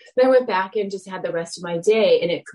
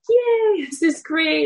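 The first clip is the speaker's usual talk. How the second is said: high, loud delighted yay; gleeful little cheer; excited yay; 10 semitones higher, 4 dB louder, slower than usual. Retold or imitated. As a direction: squeal it like a happy kid. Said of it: "I was like".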